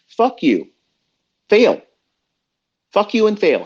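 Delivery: high, punched angry dismissal; defiant retort. Real, not acted. To critics of his long rants, defiant high retort. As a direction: throw it back at them, pitched high.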